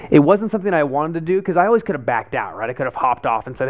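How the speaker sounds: ordinary delivery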